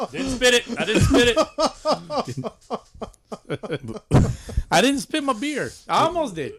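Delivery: high, laughing protest. Real, not acted. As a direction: laugh through the words. Laughing after near spit.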